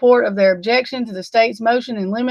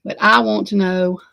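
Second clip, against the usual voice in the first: normal talking voice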